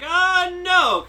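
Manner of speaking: high, loud imitated protest; alarmed refusal. Podcaster voicing a character refusing a high school visit, alarmed. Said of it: "And Nagasaki's like".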